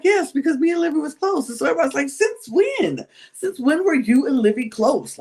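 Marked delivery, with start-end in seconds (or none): 0.0-1.5 high-pitched, pleading imitation of a housemate; tearful mock Linda. Housemate defending her tears to the doubters, mock tearful. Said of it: "all of a sudden you crying". 2.1-5.2 high-pitched disbelieving challenge; mock incredulous. Group challenging a housemate's claimed friendship, incredulous.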